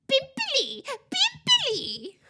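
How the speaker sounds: very high, loud jeering yell; taunting yell. A narrator's reading. Poltergeist chases the fleeing ghost, yelling. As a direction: shriek the nickname as a mocking taunt.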